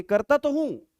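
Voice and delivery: करता तो हूं pitched high, insisting; emphatic insistence. Shown as a demonstration. Teacher acting a defensive reply, emphatic. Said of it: "जोर देके".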